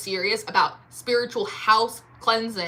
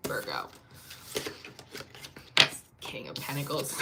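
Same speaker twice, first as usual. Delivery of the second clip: much lower and softer mutter; low aside; 8 semitones lower, 10 dB quieter than usual. Real, not acted. Reader mutters the card name to Virgo, low and soft. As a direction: drop it under your breath.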